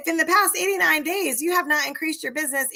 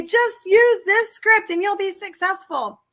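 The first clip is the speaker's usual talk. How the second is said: higher, louder, slow mock salesman; mocking a sales pitch; 6 semitones higher, 5 dB louder, slower than usual. Mocking programs that promise success, in a hammed-up pitch. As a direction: parody the slick seller's promise. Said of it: "and say".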